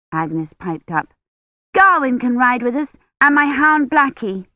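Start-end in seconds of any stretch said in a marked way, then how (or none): 1.7-4.5 put-on higher, louder child pleading; a girl keen to bring her dog along; eager little girl. Agnes begs the ladies to let them ride, an eager child.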